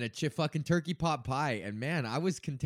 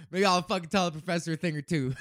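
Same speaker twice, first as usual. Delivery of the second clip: louder joking line framed by laughs; laughing brag; 5 semitones higher, 7 dB louder, faster than usual. Real, not acted. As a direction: laugh through the cheeky brag. Jokes about lecturing the professor.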